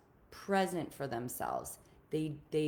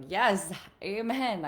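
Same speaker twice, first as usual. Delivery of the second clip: loud, drawn-out amen of agreement; slow, raised, wholehearted yes; emphatic agreement; 5 semitones higher, 7 dB louder, slower than usual. Retold or imitated. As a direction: voice a listener heartily agreeing. Voicing eager agreement of callers.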